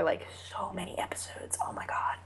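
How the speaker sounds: breathy, hushed emphasis; exasperated whisper. Real, not acted. Fan who waited so many episodes, exasperated whisper. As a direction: drop into a drained whisper.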